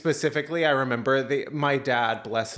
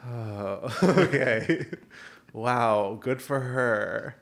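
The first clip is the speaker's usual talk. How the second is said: sleepy dad; much slower than usual. Retold or imitated. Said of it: "while he went".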